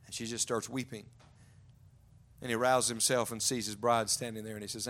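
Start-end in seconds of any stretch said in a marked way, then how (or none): none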